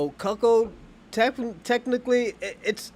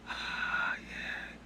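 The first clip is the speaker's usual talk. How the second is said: breathy whispered "ah, yeah" of a man watching; imitating a whispered moan; 11 dB quieter than usual. The husband in the corner moaning to himself, whispered. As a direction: whisper it like a guy caught up in the moment.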